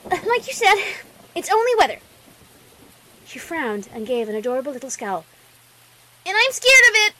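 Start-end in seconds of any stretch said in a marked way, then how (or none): 0.1-2.0 put-on high, loud child voice; squeaky little-girl voice; hesitant little filly. Filly hesitantly repeats reasoning. 6.2-7.1 put-on high, loud childish confession; squeaky, insistent little-girl voice; scowling little filly. Filly admits fear, scowling.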